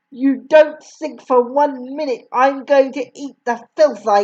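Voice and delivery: raging headmistress. A narrator's reading. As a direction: rant like a furious tyrant. Headmistress rants at the boy.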